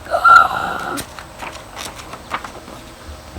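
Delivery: big, loud mime's gasp; acted gasp. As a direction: gasp sharply in place of words. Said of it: "he's like".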